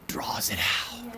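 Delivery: slow, breathy whispered ending. Acted. Preacher reads the proverb and trails into a slow whisper. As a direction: whisper it slowly, drawing it out. Preacher ends verse in hush.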